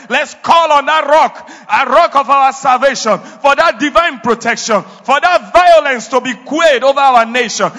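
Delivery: loud, rising preacher's prayer; fervent prayer. Real, not acted. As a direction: pray it out with full force.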